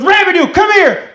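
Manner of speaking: high, loud yell across to a soldier; angry sergeant's call. Retold or imitated. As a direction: summon him like a furious sergeant. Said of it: "he got really angry".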